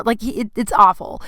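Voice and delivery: ordinary talk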